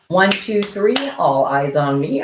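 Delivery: loud sing-song classroom call; teacher call. Pretend. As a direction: grab a class's attention.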